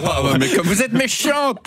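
high, drawn-out playful protest; mock offence. Columnist teased by the host about loving nobody, mock offended.

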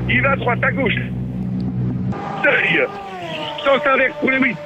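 excited sports commentator calling a toy race; race commentary. Acted. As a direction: call the race as it happens. Live race play-by-play.

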